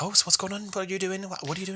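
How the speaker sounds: high, soft, chatty cabbie impression; lightly mimicked inquisitive cabbie; imitating taxi driver. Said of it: "he was like".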